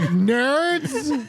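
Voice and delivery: high, drawn-out NERDS, rising then falling; imitated disdain. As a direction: stretch out NERDS in mock disbelief. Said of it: "She's just like".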